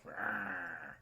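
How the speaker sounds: soft, low toy growl; mechanical wolf snarl. Retold or imitated. Wolf on the carousel snarling, soft mechanical growl. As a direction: imitate a small clockwork snarl. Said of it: "It's like a mechanical".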